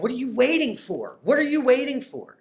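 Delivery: urgent pleading. Retold or imitated. Urging himself to sell.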